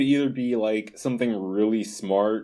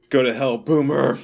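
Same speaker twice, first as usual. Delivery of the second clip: raised, louder mock jeer; higher-pitched comic heckle; Future Devil taunt; 4 semitones higher, 5 dB louder than usual. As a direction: toss it out as a playful insult. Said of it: "the Future Devil was just like".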